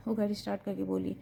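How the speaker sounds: usual speaking voice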